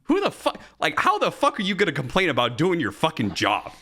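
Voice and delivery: angry outburst. Retold or imitated. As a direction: blow up at a coworker who complained about doing the job. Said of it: "I was so fucking mad".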